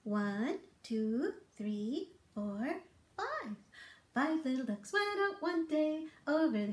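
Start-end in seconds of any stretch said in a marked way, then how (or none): none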